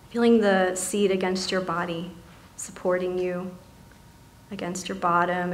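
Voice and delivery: soft, calm meditation voice with long pauses; guided meditation. Acted. Meditation leader settling seated listeners into body and breath, softly guiding.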